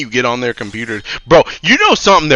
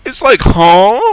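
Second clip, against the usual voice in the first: much higher, louder disbelief; incredulous outburst; 12 semitones higher, 6 dB louder than usual. Real, not acted. IT guy to a coworker buying back a busted laptop, much higher and louder disbelief. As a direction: shriek in disbelief at them.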